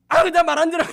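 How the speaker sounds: loud, high mock tantrum; a stubborn kid refusing to listen; defiant child. Believer acting like a defiant child toward God, loud mock tantrum. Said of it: "이러고".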